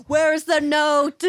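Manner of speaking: high, slow, very loud demand; mock demanding shout. Pretending to question a ghost at seance, mock demanding shout. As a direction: bellow it out in pretend outrage.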